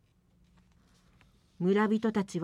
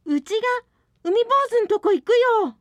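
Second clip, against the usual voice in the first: high and loud, quick; resolute declaration; 12 semitones higher, 6 dB louder, faster than usual. Acted. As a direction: blurt out a brave decision. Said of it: "松が慌てて".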